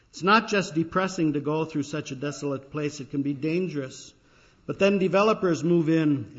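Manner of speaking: reading a quoted newsletter. Acted. Pastor reading a friend's newsletter to the congregation, emphatic. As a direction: read it out like a pulpit reading, with energy.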